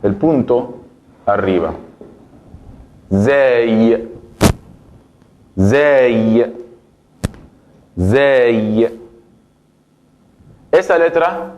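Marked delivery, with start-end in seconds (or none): 3.1-9.0 slow demo of zay, buzzing z; Arabic letter zay. Shown as a demonstration. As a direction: say zay with a voiced z. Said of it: "la letra zay". (pronunciación árabe de zay, con z sonora)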